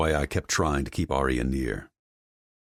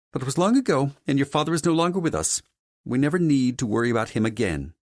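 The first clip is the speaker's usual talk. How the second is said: guarded mother, high-pitched and brisk; dismissive mother character; 12 semitones higher, 5 dB louder, faster than usual. Acted. Mother shuts down talk of the father, dismissive high character voice. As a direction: brush the past aside in a high, haughty voice.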